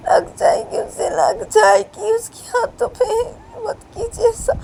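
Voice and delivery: high, loud desperate begging; begging a mother to stop; tearful pleading. Acted. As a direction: plead through tears. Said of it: "ये बोलती है".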